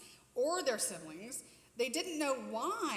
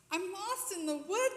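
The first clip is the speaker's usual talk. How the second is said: put-on frightened child; 7 semitones higher, 4 dB louder than usual. Frightened lost child speaks.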